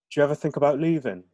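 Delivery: put-on Brummie accent. [name] asks his friend about leaving, in Brummie accent. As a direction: ask it in a Birmingham accent. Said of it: "aren't familiar with the Brahmi accent".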